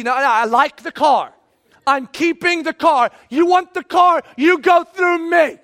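mock tough-guy bluster rising to a shout; defiant refusal. Acting out a defiant bad strategy.

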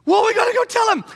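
high, loud eager voice; eager exclaim. Pretend. Women urging each other to run upstairs, high and eager. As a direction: rush to go tell.

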